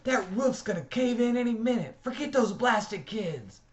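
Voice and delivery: put-on Dally screaming at the boy inside the burning church. A narrator's reading. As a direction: scream at him to get out now. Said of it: "he screamed".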